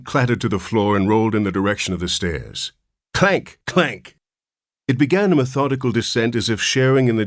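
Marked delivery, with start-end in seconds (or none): 3.1-4.2 clanking sound. A narrator's reading. Basin clattering down stairs.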